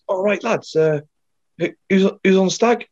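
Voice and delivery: a hint of a cheery corporal; lightly voiced corporal checking on the lads; imitating the corporal. Corporal voiced in army story.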